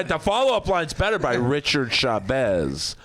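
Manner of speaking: ordinary delivery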